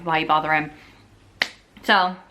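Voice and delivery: ordinary talk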